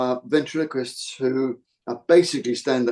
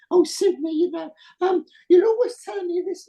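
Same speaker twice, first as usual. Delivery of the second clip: very high-pitched puppet wife; Gladys. Retold or imitated. Imitating the wife bantering with her husband, high voice. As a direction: squeak it way up high like a dummy wife. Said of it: "the high voice for little Gladys".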